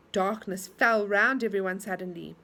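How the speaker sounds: usual speaking voice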